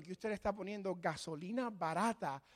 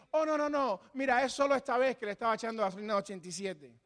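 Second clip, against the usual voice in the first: higher, fast dismissive excuse; excuse-making; 3 semitones higher, 3 dB louder, faster than usual. Pretend. The sinner excuses the cheap gas, fast and defensive. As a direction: brush it off quickly. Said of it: "no decir".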